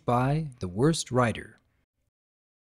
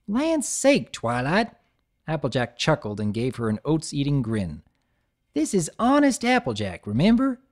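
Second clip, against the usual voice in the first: high folksy protest; chuckling country pony; lightly twangy farm mare; Applejack voice; 4 semitones higher than usual. Acted.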